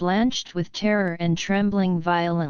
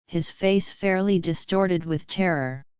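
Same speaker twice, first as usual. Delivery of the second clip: ordinary delivery, nothing marked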